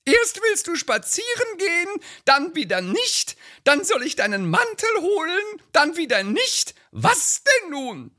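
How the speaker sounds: hohe, drängende Ehefrauenstimme; nörgelnde Ehefrau. Acted. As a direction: den Mann antreiben. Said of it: "so ein Lieblingstext". (Ehefrau drängt ihren Mann, hohe Stimme)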